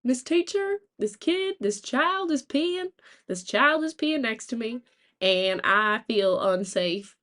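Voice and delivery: put-on high, drawn-out Southern child tattling; Southern accent. Kid reporting a classmate to teacher, Southern accent. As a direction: complain to the teacher in a hammed-up Southern drawl, starting high. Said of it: "I didn't talk with a, with a Southern accent".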